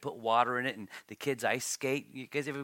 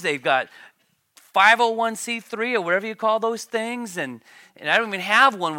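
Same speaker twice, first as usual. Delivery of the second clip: louder, higher, worked-up complaint about the wicked; envious rant; 4 semitones higher, 8 dB louder than usual. Retold or imitated. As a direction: rant like a jealous man counting their riches. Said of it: "Here Asaph says".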